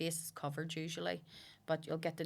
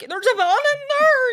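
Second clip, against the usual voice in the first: put-on far higher, louder shrieking girl; mock scream; 18 dB louder than usual. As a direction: scream it even more shrilly in mockery. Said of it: "ran out screaming".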